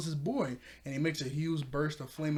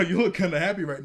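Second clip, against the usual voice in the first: louder, laugh-tinged retold taunt; amused mock quote; 4 semitones higher, 10 dB louder than usual. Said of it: "laughs It's like".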